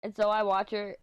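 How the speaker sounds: ordinary talk, nothing marked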